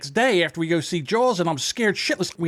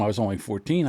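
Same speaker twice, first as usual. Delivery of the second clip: ordinary talk, nothing marked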